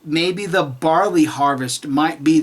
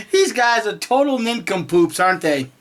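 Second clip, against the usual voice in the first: normal talking voice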